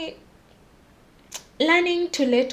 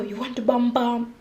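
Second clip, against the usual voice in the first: ordinary delivery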